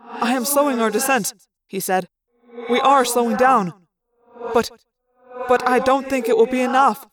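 echoing alien voice, measured and formal; composed alien officer. Acted. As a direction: report the danger gravely, without panic.

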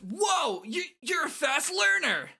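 high, amazed character voice; excitable cartoon amazement; Shooter. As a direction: gush it way up high. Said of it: "Shooter says".

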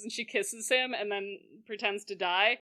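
normal talking voice

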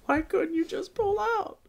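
very high, crying plea; sobbing girl. A narrator's reading. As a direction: cry the question in a high girl's voice. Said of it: "she sobbed".

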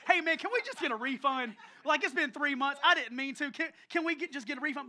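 hammed-up urgent plea; frantic pleading. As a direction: act out begging for a refund in a panic. Pleading with customer service, acted.

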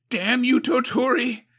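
loud, high curse; furious yell. A narrator's reading. Suko curses Toturi before the council, yelling. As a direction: hurl it at him. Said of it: "Suko yelled".

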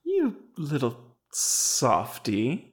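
slow fond teasing. Acted. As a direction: drag it out affectionately. Teasing a friend fondly.

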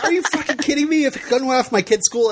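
high, fast and incredulous; fast, high mock outrage at a shrugging dad; incredulous outburst. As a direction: blurt it out in disbelief. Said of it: "I'm like".

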